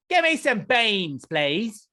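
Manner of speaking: high, slow hammed-up British voice; mock British accent. Asking for beans in a mock British accent. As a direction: drawl it like a silly Brit.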